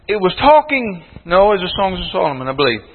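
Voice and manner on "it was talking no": ordinary delivery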